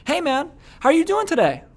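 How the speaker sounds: very high-pitched, exaggerated greeting; extreme uptone. Shown as a demonstration. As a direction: push the whole question way up high. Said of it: "I'll do a really extreme version of it".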